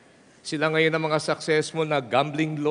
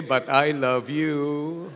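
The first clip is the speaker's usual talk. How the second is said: slow model line; much slower than usual. Shown as a demonstration. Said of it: "look at that person and say".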